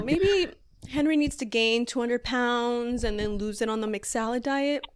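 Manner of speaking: usual speaking voice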